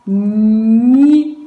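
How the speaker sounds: "ni" called out loudly and distinctly; loud dictation. Shown as a demonstration. Teacher dictating the syllable to pupils, loudly. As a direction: project "ni" to the class. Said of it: "teacher dictating a syllable".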